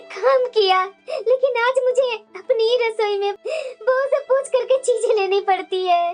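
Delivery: aggrieved lament with sobbing breaths; tearful complaint. Acted. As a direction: plead your case to your husband through tears. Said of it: "तुम्हारी आंखों में आंसू क्यों है". (सिसकते हुए दुखी स्वर में)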